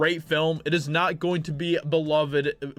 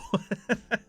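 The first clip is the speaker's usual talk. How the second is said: short higher laugh at the joke; 4 semitones higher, 4 dB quieter than usual. Real, not acted. Vlogger laughing at the voice actor's joke tweet.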